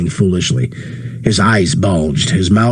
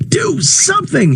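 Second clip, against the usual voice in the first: high, frantic scream; desperate plea to bystanders. A narrator's reading. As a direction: scream at the onlookers. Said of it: "he screamed".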